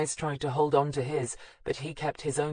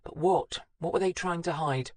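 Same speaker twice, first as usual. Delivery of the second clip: ordinary delivery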